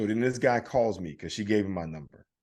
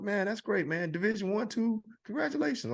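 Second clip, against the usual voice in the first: friendly salesman; 11 semitones higher than usual. Retold or imitated. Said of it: "He goes".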